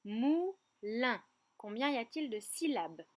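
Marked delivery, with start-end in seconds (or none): 0.0-1.2 "moulin" split as mou, lin; syllable by syllable. Shown as a demonstration. Teacher has children count syllables, word cleanly split. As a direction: separate the two beats of "moulin". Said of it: "compter les syllabes du mot".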